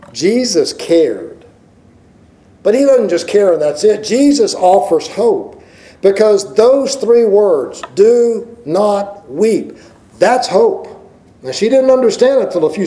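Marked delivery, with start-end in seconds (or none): 2.6-7.8 high, driving preacher's voice; full preaching. Acted. 8.0-9.8 very slow, each word "Do not weep" stretched; drawn out. Acted. Key words drawn out.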